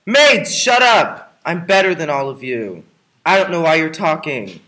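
furious arrogant outburst; angry shout. Acted. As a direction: yell at the noisy maids to shut up. Yells at the maids.

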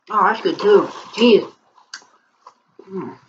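chewing while talking; speaking between bites of taco; mouth full. Real, not acted. Seen 0: Holds taco; mouth closed, working between bites during "Mm.

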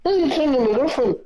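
mock a petulant kid; whiny baby voice. [name] mocking Jack as a whining baby, high whine.

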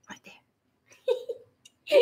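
breathy giggling whisper; laughing whisper. Real, not acted. Laughing at the drink label.

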